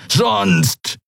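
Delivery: high, menacing single word; threatening. A narrator's reading. Halbhorn warning his captive, threatening. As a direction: leave the threat hanging. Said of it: "drohte er".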